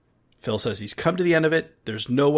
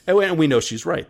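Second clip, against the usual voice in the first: normal talking voice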